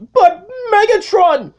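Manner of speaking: high, loud whining cry; a squeaky underling protesting; whiny minion. Acted. Needle Nose interrupts Megatron with a high whiny cry.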